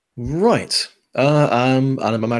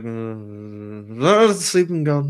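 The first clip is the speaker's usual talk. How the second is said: sleepy mumbling mushroom; drowsy little fungus murmur; much slower than usual. Acted. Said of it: "You just kind of hear a mushroom voice".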